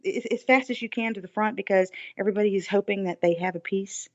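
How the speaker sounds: ordinary talk, nothing marked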